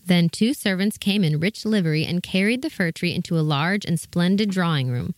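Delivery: ordinary delivery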